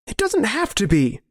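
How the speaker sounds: high, pleading protest; desperate plea. Acted. Narrator arguing with his hardened friend, desperate plea. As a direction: plead with him, voice pitched high.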